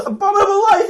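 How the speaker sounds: laughing high-pitched outrage; incredulous shout. Acted. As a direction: shriek the words back in disbelief.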